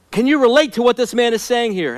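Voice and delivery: normal talking voice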